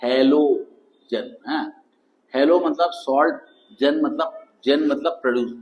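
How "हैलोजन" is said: Halogen stretched out very slowly; slow answer. Shown as a demonstration. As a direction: draw out halogen for the class. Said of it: "ये क्या होता है?". (Halogen को बहुत धीरे खींचकर बोलें)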